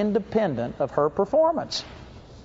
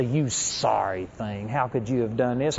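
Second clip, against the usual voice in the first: low, soft, slow accusing devil; sneering devil; 8 semitones lower, 7 dB quieter than usual. Retold or imitated. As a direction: drag it out like a condemning devil. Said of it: "devil ... will come and say".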